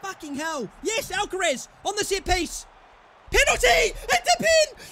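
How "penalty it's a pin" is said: high, loud yelling for a decision; urgent shouted appeal. Real, not acted. Player demanding a penalty from the referee, urgent shout. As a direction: yell it at the referee, pitch leaping up.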